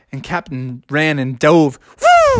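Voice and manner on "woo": high-pitched diving whoop. Acted. Captain diving for the box, whooping. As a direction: whoop upward like a cartoon leap.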